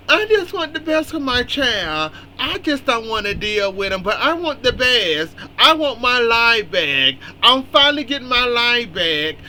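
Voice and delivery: high, whiny mock lament; mocking whiny Sophia. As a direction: parody a self-pitying mother. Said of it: "All that moping and crying".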